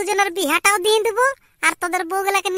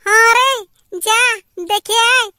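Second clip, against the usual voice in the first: mother's loud delighted go-ahead; loud eager yes; 3 semitones higher, 5 dB louder than usual. Acted. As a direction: call it out gladly to the son. Mother eagerly agrees to marriage.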